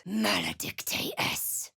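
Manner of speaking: slow, breathless whispered incantation; whispered curse. Acted. Cleric casting a curse, slow whisper. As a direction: hiss the curse out slowly, no voice.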